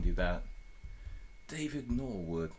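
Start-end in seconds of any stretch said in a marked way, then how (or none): 1.5-2.5 slow, posh British accent try; slowed British accent attempt. Shown as a demonstration. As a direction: say the name like an Englishman. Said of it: "Can you do a British accent?".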